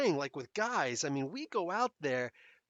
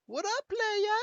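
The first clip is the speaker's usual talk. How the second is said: voice cracking up an octave; 4 dB louder, slower than usual. Shown as a demonstration. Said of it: "let's see if I can try it again".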